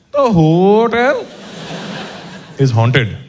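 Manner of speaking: put-on bellman's grave warning, Indian accent, slow build. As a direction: bellman telling the guest the hotel is haunted, Indian accent. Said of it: "and he goes".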